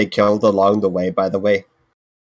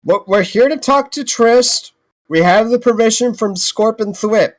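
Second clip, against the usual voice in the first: high-pitched appeal; 12 semitones higher than usual. Acted. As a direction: call out in a high, urgent voice. Player calls to screeching kobolds.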